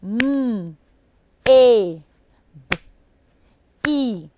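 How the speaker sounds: maybe split into separate sounds; teacher segmenting the sounds; sounding out phonemes. Shown as a demonstration. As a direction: give each sound of maybe on its own, with pauses. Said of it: "How many phonemes did you identify".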